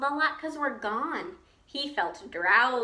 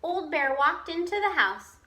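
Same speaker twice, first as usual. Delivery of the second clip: ordinary delivery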